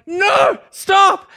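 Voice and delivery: high, loud yell at the cars; shouting stop. Retold or imitated. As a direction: flag the traffic down with your voice. Yelling at traffic to stop.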